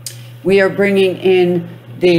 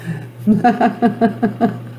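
demo laugh; 4 semitones higher than usual. Shown as a demonstration. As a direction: laugh freely to show them how. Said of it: "I don't care".

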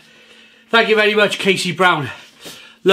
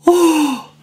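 pained high yelp; 11 semitones higher than usual. Real, not acted. Eating very hot noodles.

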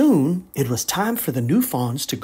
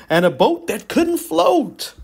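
plain reading voice

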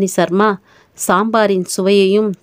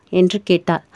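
ordinary delivery, nothing marked

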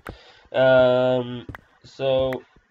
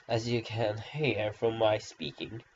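ordinary talk

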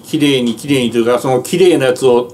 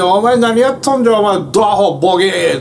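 imitated Kansai scolding; 5 semitones higher than usual. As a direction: retell the Tokyo driver's rough Kansai abuse with a bit of bite. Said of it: "関西弁で".

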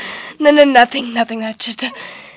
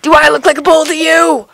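loud struggling shout; 4 dB louder than usual. Acted. Struggling against attacker.

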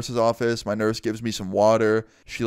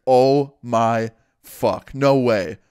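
stunned disbelief; 5 dB louder, much slower than usual. Retold or imitated. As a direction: imitate a stunned friend, drawn out and loud. Friend reacts to shocking news.

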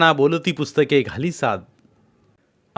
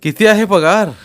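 louder, firm and serious; serious question; 7 semitones higher, 8 dB louder than usual. A narrator's reading. Kumar seriously asks Vaibhav his salary, firm. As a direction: press for the answer, no joking. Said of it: "कुमारनं गांभीर्यानं विचारलं".

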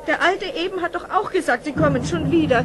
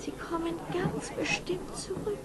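whisper; 11 dB quieter than usual. Acted. Mother comforts herself, hushed.

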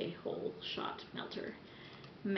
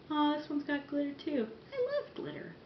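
cutesy glee; 8 semitones higher than usual. Real, not acted. Squealing over glitter.

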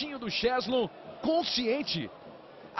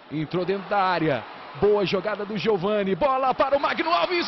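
getting louder as the attack builds; building excitement; 3 semitones lower, 5 dB louder than usual. Real, not acted. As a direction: ramp up toward the shot. Building attack, rising volume.

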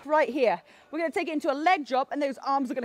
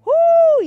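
high whoop; 12 dB louder than usual. Real, not acted. Fitness cheer mid-workout.

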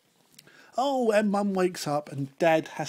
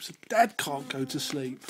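plain reading voice

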